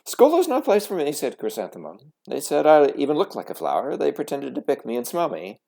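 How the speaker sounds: fast, loud, high tumbling complaint; a child blurting out her hurt; upset complaint. Acted. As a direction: pour out the grievance in a rush.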